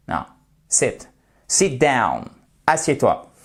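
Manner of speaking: normal talking voice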